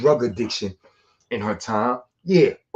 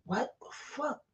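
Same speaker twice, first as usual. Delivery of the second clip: stunned; 13 semitones higher, 14 dB quieter, slower than usual. Retold or imitated. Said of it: "I was like".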